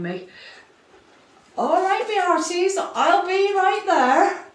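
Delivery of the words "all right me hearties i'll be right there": loud, high, slow pirate call; loud slow pirate accent calling to the crew. Acted. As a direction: shout back to the crew like a pirate.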